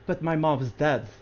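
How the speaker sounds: subdued mock-tearful reply, dropped low; tearful, retold. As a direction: quietly act out someone tearfully accepting a loss. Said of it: "tears are, f-, are dropping".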